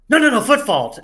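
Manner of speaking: loud high quick no no no call; urgent referee shout; ref call. Retold or imitated. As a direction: call the foot fault out sharply. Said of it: "I started saying".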